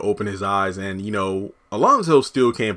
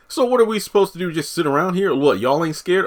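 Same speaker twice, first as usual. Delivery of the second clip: much higher, fast disbelieving questions; incredulous; 8 semitones higher than usual. Retold or imitated. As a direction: fire off panicked questions. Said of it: "Kareem being like".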